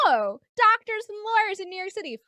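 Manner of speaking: high, loud, thrilled reaction; mock delight. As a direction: gush over the news. Acting out their delighted reaction.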